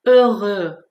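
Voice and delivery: heureux with two rounded eu vowels; correct French eu. Shown as a demonstration. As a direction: model heureux loudly, never "oro". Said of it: "ببینید تلفظش رو دقت کنید".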